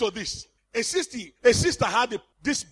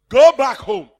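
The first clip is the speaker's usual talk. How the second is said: retold command; 10 dB louder than usual. Preacher voices himself commanding sister.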